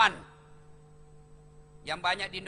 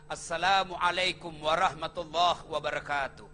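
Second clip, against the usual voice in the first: full salam; 6 semitones lower than usual. Acted. Prophet greets in full.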